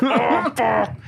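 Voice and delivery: high, mock-agonized howl; hammed-up pain cry. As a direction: yelp it high, acting out the hurt. Acts out toenail pain.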